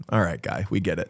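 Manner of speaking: low, quiet, weary dismissal; exasperated. Retold or imitated. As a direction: sigh it off like you're over it. Exasperated listener reaction.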